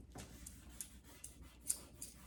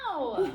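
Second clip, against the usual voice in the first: yelp; 5 semitones higher, 9 dB louder than usual. Real, not acted. Pretend slap reaction.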